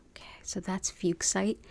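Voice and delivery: soft whisper. Real, not acted. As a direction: breathe it quietly. Quiet wrap-up aside.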